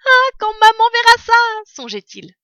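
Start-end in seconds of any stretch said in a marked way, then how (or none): 0.1-1.3 high, loud burst of joy from the fox cub; overjoyed thought. A narrator's reading. Fox cub dreaming of showing his mother, overjoyed high cry. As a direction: fox cub crying out in delight, much higher and louder. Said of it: "Il était fou de joie".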